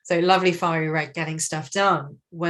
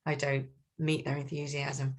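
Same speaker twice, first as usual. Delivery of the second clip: quiet inner thought; 3 semitones lower, 7 dB quieter than usual. Retold or imitated. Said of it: "cool blue sees sunshine yellow coming and thinks".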